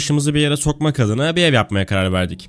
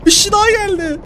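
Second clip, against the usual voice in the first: being chased; 4 dB louder than usual. Real, not acted. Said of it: "Attığım çığlığı duydun değil mi".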